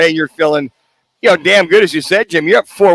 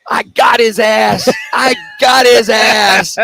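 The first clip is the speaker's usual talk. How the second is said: drawn-out, slow mock yell, higher; imitating a jacked-up yell; 4 semitones higher, slower than usual. Co-host reenacting a teammate cheering the rookie, mock excited yell. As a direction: hype the rookie up like a teammate in the dugout.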